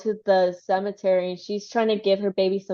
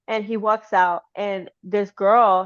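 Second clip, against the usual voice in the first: ordinary delivery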